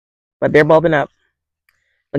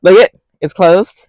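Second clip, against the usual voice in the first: excited; 6 semitones higher, 6 dB louder than usual. Real, not acted. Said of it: "I'm super excited about it".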